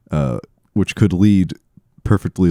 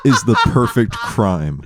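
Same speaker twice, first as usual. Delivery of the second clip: laughing; 7 semitones higher than usual. Retold or imitated. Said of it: "He said".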